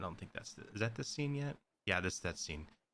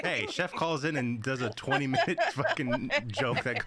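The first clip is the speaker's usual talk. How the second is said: talking through laughter, pitched up; laughing tease; 5 semitones higher, 4 dB louder than usual. Real, not acted. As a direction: rib a friend while cracking up. Teasing the co-host amid laughter.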